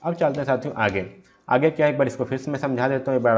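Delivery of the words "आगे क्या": ordinary delivery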